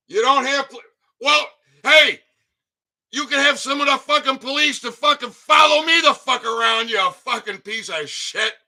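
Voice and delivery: high, loud furious taunting; angry rant. Real, not acted. Mocking the governor about police protection, furious and loud. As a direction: spit the insults at her.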